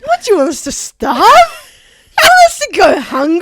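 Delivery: mock outrage. Customer asking if they should starve, mock outrage. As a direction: plead in fake indignation.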